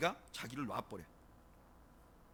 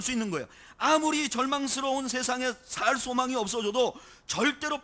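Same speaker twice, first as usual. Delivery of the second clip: loud, raised pulpit voice; full-force preaching; 7 semitones higher, 9 dB louder than usual. Real, not acted. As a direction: proclaim hope with driving conviction.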